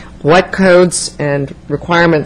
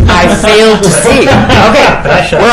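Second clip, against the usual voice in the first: talking through real laughter; laughing; 5 semitones higher, 4 dB louder, slower than usual. Real, not acted. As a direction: say it while still laughing. Chair laughing after joke.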